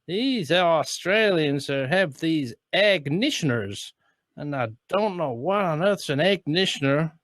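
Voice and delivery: lightly mimicked American puzzlement; mock American reporter. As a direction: read it like a baffled American newsman. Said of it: "and then it says".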